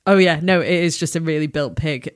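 normal talking voice

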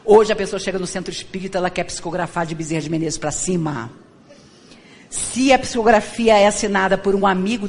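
usual speaking voice

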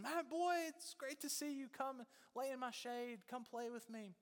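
very high, singing-out welcome; delighted tree. Acted. Tree welcomes the boy back to play, high and delighted. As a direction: greet a long-missed child in a high storybook voice.

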